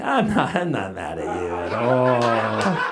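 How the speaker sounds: low, slow, strained fake calm; drawn-out, falsely reassuring denial; lying through teeth. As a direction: force it out through clenched teeth. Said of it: "I'm lying through my teeth".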